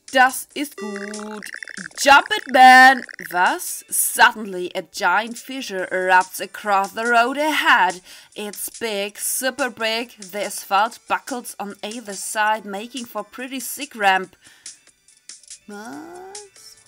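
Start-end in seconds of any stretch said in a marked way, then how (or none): 2.0-3.0 loud, punchy yell; shouted exclamation. Acted. Acts out game exclamation. 15.7-16.8 soft, high, rising question; quietly puzzled, voice sliding up; baffled question. Real, not acted. Baffled by the English text.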